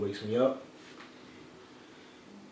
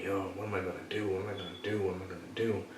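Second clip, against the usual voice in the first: hushed, lost muttering to himself; low repeated worried murmur; quiet despair; 7 semitones lower, 17 dB quieter, faster than usual. Retold or imitated. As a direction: mutter it like you're stuck with no way out. Despairing alone in his car.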